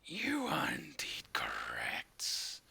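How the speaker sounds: very deep, slow, whispered demon rasp; deep demonic voice. Acted. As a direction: growl it like a possessed warlock. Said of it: "that deep demonic voice".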